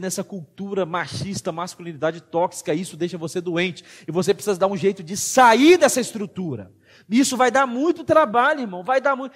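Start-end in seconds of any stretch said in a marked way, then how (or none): 4.1-9.3 louder, impassioned sermon delivery; fiery preaching. Acted. Pastor urging the men of the church, preaching at full force. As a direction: drive every line home to the congregation.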